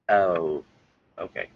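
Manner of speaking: low, deflated acceptance, fading away; acted disappointment. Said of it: "you're kinda sad".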